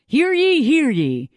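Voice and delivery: high, loud mock herald call; town crier. Host opens a mock royal decree, hammed-up herald call.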